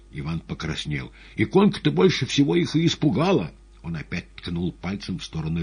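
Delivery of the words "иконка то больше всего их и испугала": high, loud insistent outburst; agitated patient. Acted. Agitated patient explains himself to doctors.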